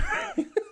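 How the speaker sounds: a burst of high laughter. Real, not acted. Laughing at his own mic announcement, high-pitched. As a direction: laugh at your own story.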